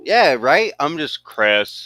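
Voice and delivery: ordinary talk, nothing marked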